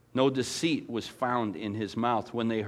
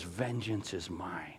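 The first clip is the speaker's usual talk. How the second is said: Vengeance is mine dropped much softer and slow; solemn hushed verse; hushed solemn quote; 8 semitones lower, 12 dB quieter, slower than usual. Shown as a demonstration. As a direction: let God's words fall quiet and weighty. God's words, hushed.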